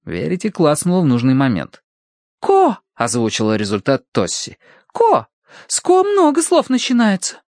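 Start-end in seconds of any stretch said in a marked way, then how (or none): 2.4-2.8 put-on very high girlish announcement; Tossie's voice. A narrator's reading. Tossie voices the spirit's result, high girlish voice. 4.9-7.4 put-on very high girlish remark; Tossie's voice. A narrator's reading. Tossie ponders the syllable, high girlish voice.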